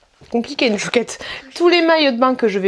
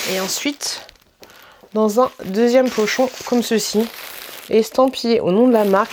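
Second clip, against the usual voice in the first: ordinary delivery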